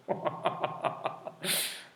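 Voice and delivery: breathy cackle, barely voiced; real helpless laugh. Real, not acted. Teacher cracking up at "outroduction", real laughter. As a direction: crack up, trying to get words out. Cracks up at student's invented word.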